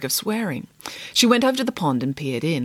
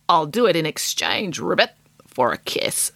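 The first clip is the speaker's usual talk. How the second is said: low, breathy sly frog croak; a creaky frog making a deal; 3 semitones lower than usual. Acted. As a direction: bargain like a sly little frog. Frog bargains.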